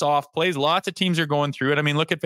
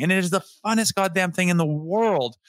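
normal talking voice

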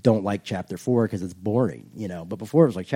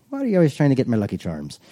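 put-on Irish accent; faster than usual. Joking Irish leprechaun voice.

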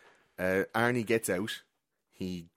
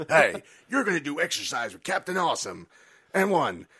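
loud, high exercise-video instructor; Captain Awesome; 6 semitones higher, 5 dB louder than usual. Retold or imitated. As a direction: bark out a hammed-up workout video intro. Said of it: "He's like".

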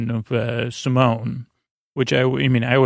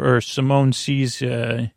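ordinary delivery

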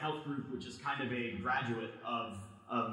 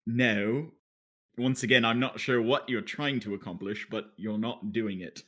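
louder mock British put-down; British accent; 6 dB louder than usual. Host rejects co-host's Scottish attempt, British accent. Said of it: "I'm talking in a British accent".

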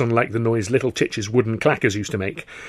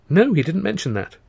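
higher-pitched, mildly put-out reply; mild indignation; 9 semitones higher, 4 dB louder than usual. Acted. Answering an old friend who was looking, mildly indignant. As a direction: answer with a touch of indignant surprise. Surprised reply in voiced dialogue.

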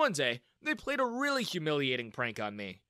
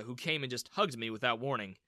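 ordinary delivery

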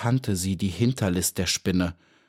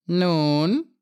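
high, louder, scornful "Nun?"; mocking; 8 semitones higher, 4 dB louder than usual. A narrator's reading. Spider taunting the trapped bee, mocking question. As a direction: taunt the captive. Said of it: "fragte sie spöttisch".

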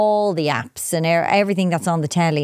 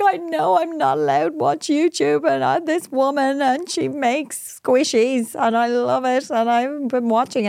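hammed-up sobbing confession, pitched up; imitated bawling; 6 semitones higher than usual. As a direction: wail it like a tearful child confessing. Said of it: "but she started bawling, crying".

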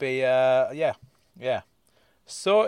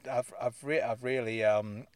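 usual speaking voice

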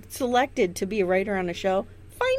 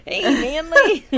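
higher, louder mock Chris Hansen greeting; 6 semitones higher, 9 dB louder, slower than usual. Host mock-greeting a predator on a podcast, loud teasing. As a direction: burst in like the TV sting host, laughing. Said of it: "Chris Hansen walks in".

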